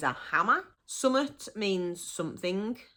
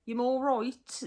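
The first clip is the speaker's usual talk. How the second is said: "You" said as "yam", raised pitch; 6 semitones higher than usual. Shown as a demonstration. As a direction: greet someone the local way with "yam". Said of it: "Yam means you are".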